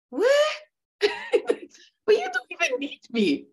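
very high, laughing what; laughing disbelief. Retold or imitated. When a fluent student thanks her, a high laughing disbelief. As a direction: squeal in amused disbelief. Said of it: "I was like".

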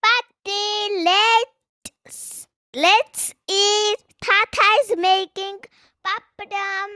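put-on loud, high child's call; yelling. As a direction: call out to grandma excitedly. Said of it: "Rosa yells".

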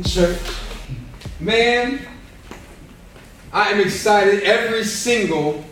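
normal talking voice

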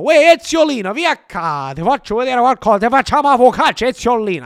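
loud, high-pitched mock Barese accent; hammed-up Bari accent, like marbles in your mouth; rough Barese accent. Host calling to coach Ezziolino, hammed-up Barese accent. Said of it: "doing my best Barese accent".

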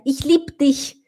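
Ich liebe dich clipped, liebe as lipd; mock sloppy foreigner; wrong German. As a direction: swallow the e of liebe. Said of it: "o estrangeiro falar".

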